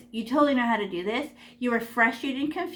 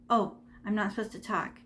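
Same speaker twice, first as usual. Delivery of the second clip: ordinary talk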